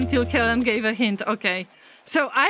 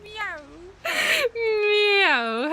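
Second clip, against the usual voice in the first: high mewing cat sound; playful meow; 10 semitones higher than usual. Pretend. Streamer playfully mewing at animals, high meow. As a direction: mew like a kitten.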